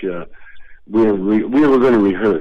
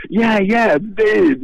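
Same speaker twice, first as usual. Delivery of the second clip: high, exasperated put-on voice; annoyed Cheech; 13 semitones higher, 5 dB louder, slower than usual. Cheech stuck outside in the heat: high annoyed imitation. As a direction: imitate an irritated friend, pitched high and pushing. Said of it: "you can hear his voice going".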